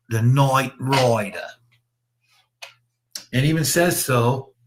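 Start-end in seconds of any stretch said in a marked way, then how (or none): 0.1-1.5 slow, proclaiming mock Australian accent; hammed-up Australian, vowels stretched wide; terrible Australian accent. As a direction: announce it grandly in a bad Aussie accent. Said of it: "Australian accent".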